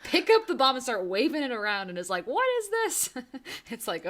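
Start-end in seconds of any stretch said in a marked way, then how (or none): 0.0-1.9 higher, playful mock urging; mock instructions. Mocking Ellie's instincts with the bomb, playfully sarcastic. 2.3-3.2 very high, cartoonish wondering voice; mock clueless question. Imitating Ellie waving a bomb, mock clueless.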